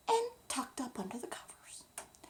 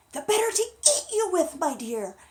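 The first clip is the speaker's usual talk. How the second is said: loud, higher wolf threat; the wolf springing his trap; menacing wolf, loud and high; 7 semitones higher, 13 dB louder than usual. Acted. Wolf about to pounce.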